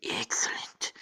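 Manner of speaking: whispered villain's gloat; hushed, scheming cartoon-villain impression; Mr. Burns. Trump gloats like a cartoon boss, whispered impression. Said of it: "he's gotta go like Mister Burns".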